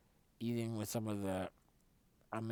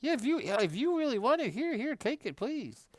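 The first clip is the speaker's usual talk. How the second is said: much higher, mock sweet lady voice; gushing lady; 8 dB louder than usual. Rich lady giving away her dish, high mock-sweet voice. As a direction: hand it over in a high gushing voice. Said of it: "She's like".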